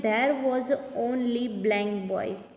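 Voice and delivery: slowed, deliberate dictation; slow reading. Shown as a demonstration. Teacher reads next sentence to pupils, slowed. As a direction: read it out slowly. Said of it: "teacher reads exercise sentence".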